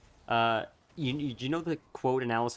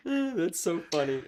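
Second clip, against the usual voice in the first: laughing through the words; real laughter while talking; 6 semitones higher, slower than usual. Real, not acted. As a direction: giggle it out, tickled. Laughing at friend's joke.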